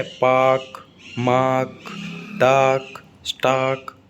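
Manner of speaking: "पाक, मार्क, डार्क, स्टार्क" with long vowel and no R; word by word, drop the R in "पाक, मार्क, डार्क, स्टार्क"; British silent R. Shown as a demonstration. Teacher listing British examples, "पाक, मार्क, डार्क, स्टार्क" with silent R. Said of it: "आर को बोला नहीं".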